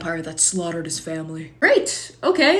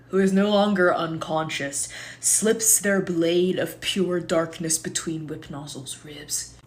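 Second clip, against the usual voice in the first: usual speaking voice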